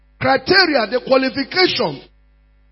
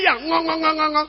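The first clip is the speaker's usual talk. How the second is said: high mimicked yammering; mocking grumble; 5 semitones higher, faster than usual. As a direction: imitate a complainer's wordless nagging. Mimics grumbling complainers in the congregation.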